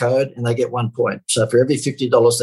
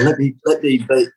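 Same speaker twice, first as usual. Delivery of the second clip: ordinary delivery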